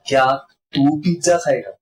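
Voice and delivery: single out तू in the question; stress on तू. Shown as a demonstration. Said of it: "पहला ये है". (तू पर ज़ोर देकर)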